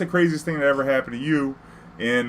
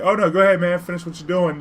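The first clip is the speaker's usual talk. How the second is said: higher, louder friendly go-ahead; polite shop owner; 6 semitones higher, 3 dB louder than usual. Retold or imitated. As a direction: wave him on like the man behind the glass. Chinese guy voiced politely.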